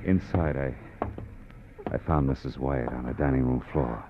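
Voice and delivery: calm detective narration; story narration. A narrator's reading. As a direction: tell it like a weary private eye looking back. Detective narrates over music.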